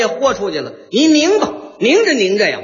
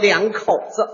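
normal talking voice

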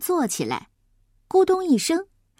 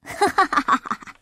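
high scornful laugh; smug laugh; 5 semitones higher than usual. Acted. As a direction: laugh at him, full of yourself. Braggart laughs after boasting.

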